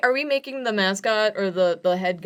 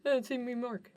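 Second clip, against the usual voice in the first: cocky dude voice; 5 semitones higher, 11 dB quieter than usual. Pretend. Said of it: "can this guy's name be Mark".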